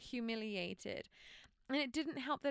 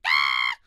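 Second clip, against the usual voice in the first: low, loud frustrated yell; mother's bottled-up frustration, loud yell; inner scream; 17 dB louder than usual. Retold or imitated. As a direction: let out a pent-up scream. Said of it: "I'm like".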